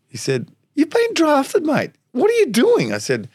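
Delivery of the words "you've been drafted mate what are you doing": very high, incredulous phone voice; excited mate. Retold or imitated. Teammate phoning to say he's been drafted, excited. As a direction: imitate a mate thrilled by the news. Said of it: "the excitement in his voice".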